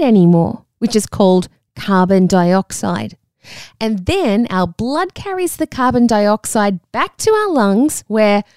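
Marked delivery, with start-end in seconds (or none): none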